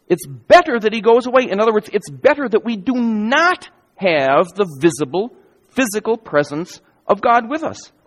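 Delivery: ordinary talk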